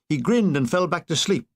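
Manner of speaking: ordinary delivery, nothing marked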